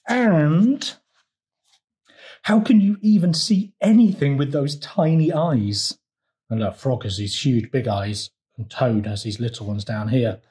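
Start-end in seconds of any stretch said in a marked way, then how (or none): 0.1-6.0 higher, goading storybook squabble; indignant retort. A narrator's reading. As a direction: pile on another jab, pitched up. Frog keeps arguing with toad.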